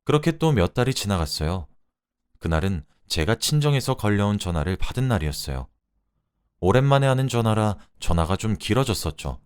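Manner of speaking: ordinary delivery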